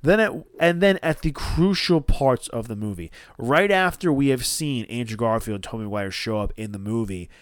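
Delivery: usual speaking voice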